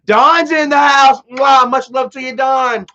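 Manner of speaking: loud, high, drawn-out welcome; big high-pitched affectionate greeting; excited shout-out. Real, not acted. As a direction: hail a friend who just arrived, with a kiss. Greets a viewer joining the stream.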